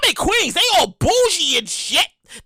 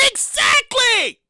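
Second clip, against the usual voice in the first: excited vindication; 10 semitones higher than usual. Real, not acted.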